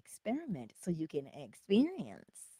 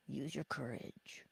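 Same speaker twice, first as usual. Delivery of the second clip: softer retry; 4 semitones lower, 16 dB quieter, slower than usual. Shown as a demonstration. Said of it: "Did I say that right?".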